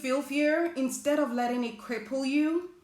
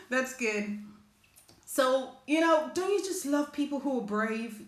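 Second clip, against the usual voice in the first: ordinary talk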